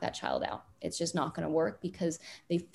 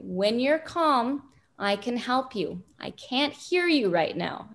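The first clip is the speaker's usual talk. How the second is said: slow, deliberate, higher and louder, each word spaced out; slow calm parent voice; 6 semitones higher, 5 dB louder, slower than usual. Shown as a demonstration. Parent to a screaming child outside, slow, raised and deliberate. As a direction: talk a screaming child down, slowly and clearly.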